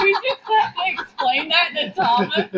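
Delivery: high, incredulous question through laughter; laughing. Real, not acted. Host teasing co-host for explaining the joke, laughing hard. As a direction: ask it while cracking up.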